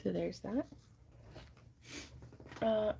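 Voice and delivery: usual speaking voice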